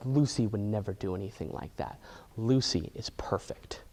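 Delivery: doting mother in a low hushed drone; deep mom voice. Retold or imitated. Mom dismisses Ben's evidence.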